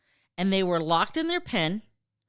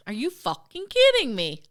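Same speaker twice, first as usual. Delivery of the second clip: higher, exasperated mock British accent; poor British accent; 6 semitones higher, 3 dB louder than usual. Farmers react to the aliens' prank, shaky British accent attempt. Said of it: "that's not my best British accent".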